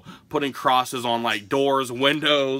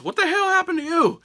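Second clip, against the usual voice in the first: much higher, startled impression of a friend; alarmed friend's voice. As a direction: sound shocked for him. Retelling friend's alarmed question.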